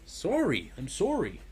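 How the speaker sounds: mock Canadian "sorry", pitch swooping up; mad Canadian. Teasing partner with a Canadian apology, mock Canadian accent. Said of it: "I turned mad Canadian".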